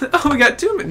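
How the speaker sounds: high and laughing through it. Real, not acted. Streamer cracks up at the two McCains, laughing. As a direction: breaks into laughter mid-word.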